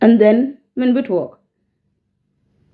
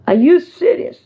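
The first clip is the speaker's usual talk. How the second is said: higher, punchier challenge; indignant disbelief; 6 semitones higher than usual. Real, not acted. Vlogger challenging a disrespectful caller, indignant and incredulous. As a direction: throw the question out in raised-pitch disbelief. Repeats the indignant question harder.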